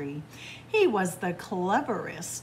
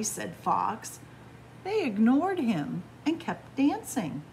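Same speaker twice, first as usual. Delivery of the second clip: usual speaking voice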